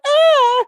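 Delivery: very high, loud shocked shriek; mock shriek. The woman squeals at the pimp, high and loud. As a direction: squeal like she's been struck. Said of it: "she's like".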